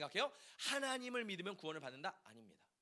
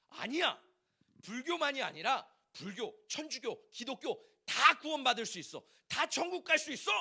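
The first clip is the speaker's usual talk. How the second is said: mock huffy protest, building louder and higher; imitating indignant child; 5 semitones higher, 6 dB louder than usual. Church student rebutting playground friends about salvation, mock indignant. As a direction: retort like a riled-up kid, acted out. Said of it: "발끈해서 말하는 거예요".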